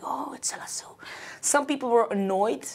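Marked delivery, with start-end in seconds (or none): 0.0-0.9 hushed whisper, mimicking onlookers; imitated recognizing whisper. Singer imitates people recognizing her in the street, whispered. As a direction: whisper it like someone spotting a star. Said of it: "Some people recognized me and was like".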